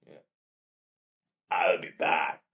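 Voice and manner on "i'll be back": low Austrian robotic line; lightly voiced Austrian cyborg; Terminator voice.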